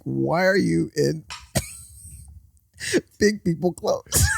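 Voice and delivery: put-on slow, high, puzzled toddler; baby voice. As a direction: wonder aloud like a confused baby. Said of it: "I'm looking at him like".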